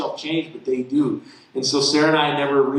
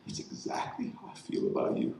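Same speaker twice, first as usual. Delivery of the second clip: hushed breath of a voice, much softer; the Lord answering the father, hushed and soft; soft voice of God; 9 semitones higher, 12 dB quieter than usual. Retold or imitated. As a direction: speak it like a tender secret from above. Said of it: "He said".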